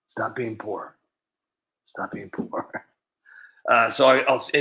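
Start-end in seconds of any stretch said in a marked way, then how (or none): 1.8-2.8 soft, slow whispered echo; whispered repeat. Retold or imitated. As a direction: breathe the quote again slowly. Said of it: "like Nancy always says".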